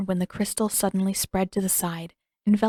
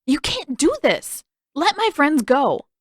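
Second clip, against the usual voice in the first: loud, higher, slow furious shout; angry demand; 8 semitones higher, 7 dB louder than usual. Acted. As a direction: shout it at the king in defiance. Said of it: "eyes blazing".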